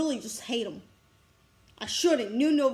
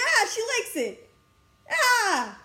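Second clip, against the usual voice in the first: high-pitched fangirl squeal; 8 semitones higher, slower than usual. Retold or imitated. Gushing because her crush likes the drawing, fangirling. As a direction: let out a high, giddy fan cry. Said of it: "fangirling with her".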